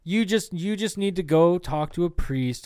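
high, loud scolding preacher; preachy outburst. Retold or imitated. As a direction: scold like a zealous believer. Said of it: "But then I was like".

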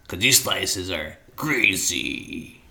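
slow, deep hype announcer; fighting game announcer. Pretend. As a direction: drag it out like an arena announcer. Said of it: "sound effect announcer voice".

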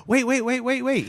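high, rapid-fire pleading imitation; put-on desperate boyfriend voice; mock pleading. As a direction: call after her in a high mock panic. Boyfriend chases her, voiced.

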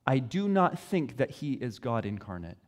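softly, gently dismissive; a quiet, polite refusal; gentle quote. Retold or imitated. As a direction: friend gently declining faith, softly. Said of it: "they will very gently say".

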